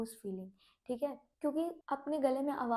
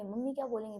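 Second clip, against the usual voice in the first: normal talking voice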